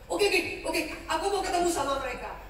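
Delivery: excited. Acted. Joseph eager to meet brothers.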